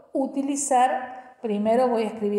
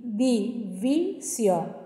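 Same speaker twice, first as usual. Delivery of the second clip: "división" drawn out in separate syllables; split into syllables; much slower than usual. Shown as a demonstration. Teacher matching syllables to letters, slowly syllable by syllable. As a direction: break "división" slowly into di, vi, sión. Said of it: "Divido en sílabas".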